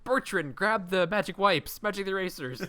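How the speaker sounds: high, loud order; mock calling out. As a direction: call to a crewman in comic urgency. Calling orders to imagined crewman.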